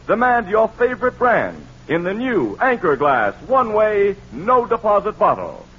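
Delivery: ordinary talk, nothing marked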